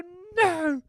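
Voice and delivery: high, loud mortified apology. Retold or imitated. Apologising to John Noakes, high and mortified. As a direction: gush out the apology in embarrassment. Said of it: "In that voice as well".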